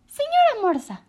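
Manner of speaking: high, loud small-bird call; a tiny bird hailing the giraffe; little bird voice. A narrator's reading. Choco calls the walrus.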